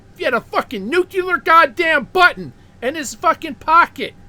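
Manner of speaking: loud, high angry rant; shouting in disbelief; angry outburst. Real, not acted. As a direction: blow up at the absurdity. Ranting about a nuclear button.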